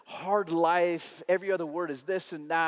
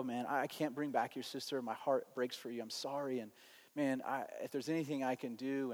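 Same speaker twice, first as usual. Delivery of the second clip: soft, low, tender consoling; loving condolence; 4 semitones lower, 11 dB quieter than usual. Retold or imitated. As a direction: gently comfort a grieving friend, retold. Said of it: "in a very just sort of loving".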